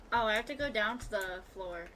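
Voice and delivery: normal talking voice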